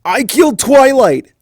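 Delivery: high, loud frantic cry; a terrified young dragon; panicked shout. Acted. Spike panicking.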